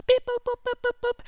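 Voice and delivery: very high electronic boops and beeps; tiny high-pitched machine bleeps; button beeps. Retold or imitated. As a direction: imitate little console buttons being pushed. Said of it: "he's pushing more buttons".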